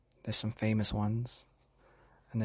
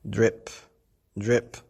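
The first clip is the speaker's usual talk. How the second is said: each drip popped out loud; dripping tap; 6 dB louder than usual. Acted. Narrator voicing the leaky tap, crisp drips. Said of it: "went the leaky kitchen tap".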